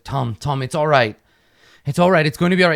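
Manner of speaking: high, loud frantic soothing; calm him down, desperate; urgent comfort. Acted. Soren comforts the moaning king.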